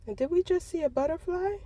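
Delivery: high, loud amazed question; surprised. Real, not acted. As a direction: blurt it out in surprise. Sudden butterfly sighting.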